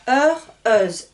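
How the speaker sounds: "Heure, heuse" as bare endings, loud, r then z; endings -eur, -euse. Shown as a demonstration. French teacher modelling masculine and feminine endings, loud "Heure, heuse". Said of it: "terminent E U R et au féminin".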